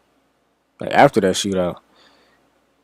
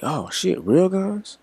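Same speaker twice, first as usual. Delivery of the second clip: alarmed surprise; 10 semitones higher, 5 dB quieter, much slower than usual. Retold or imitated. Learning the guns are real.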